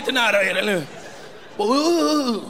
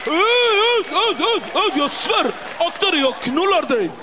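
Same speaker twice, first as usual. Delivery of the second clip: furious threat; 6 semitones higher, slower than usual. Retold or imitated. Zoran threatening the cocky kid, hammed-up fury. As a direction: blow up and threaten.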